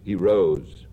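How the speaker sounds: ordinary delivery, nothing marked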